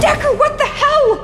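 loud, high-pitched panic; panicked shouting. Acted. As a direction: cry out in horrified alarm. Panic over dead bodies.

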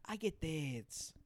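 slowed mock African accent; hammed-up African accent. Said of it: "Mine is like".

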